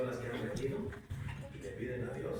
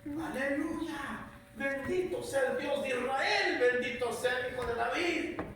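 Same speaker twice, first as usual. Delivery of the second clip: joyful cry of praise; 11 semitones higher, slower than usual. Acted. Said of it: "Dando gritos de alegría y alabando a Dios a voz".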